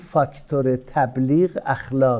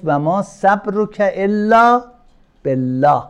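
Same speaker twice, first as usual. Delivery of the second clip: slow recitation; 6 semitones higher, 6 dB louder, slower than usual. Retold or imitated. Quoting God to the Prophet.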